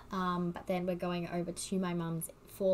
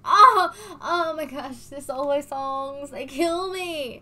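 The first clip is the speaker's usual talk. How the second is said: overwhelmed whine; 6 semitones higher, 12 dB louder, slower than usual. Real, not acted. Overwhelmed fan over solo songs.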